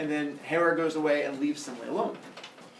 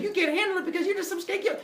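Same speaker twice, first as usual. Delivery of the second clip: high, fast mock sass; snappy mode; faster than usual. Semele going off at Zeus, snappy. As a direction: rattle it off with high-pitched attitude. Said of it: "she starts getting in a little snappy mode".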